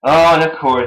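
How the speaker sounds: ordinary talk, nothing marked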